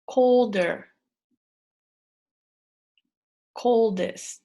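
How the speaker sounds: each word loud and clear, pausing for the class; clear model words. Shown as a demonstration. Teacher models each spelling word for students to repeat clearly. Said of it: "I'll say the word, and then you repeat after me".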